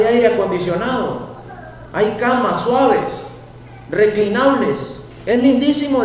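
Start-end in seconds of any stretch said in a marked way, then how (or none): 5.3-6.0 lightly voiced high gushing praise; a light mock of an admiring friend; Stacy's gushing. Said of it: "dice Stacy".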